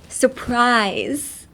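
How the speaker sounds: "surprise" stretched very slowly, mouth opening then closing; slow wide surprise. Shown as a demonstration. Teacher slowly shapes "surprise" with the mouth. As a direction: draw out "surprise" like a breaststroke. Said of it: "平泳ぎしてる気持ち".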